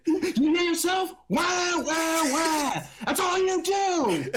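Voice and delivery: high, whiny taunt with drawn-out wails; mocking scolding. Co-host playing someone berating a baby doll, mocking whine. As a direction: mimic a crybaby and scold it, hammed up.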